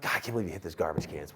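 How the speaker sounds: low, fast under-the-breath grumble; low fast annoyed grumble; grumbling gripe. Retold or imitated. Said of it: "I'm like".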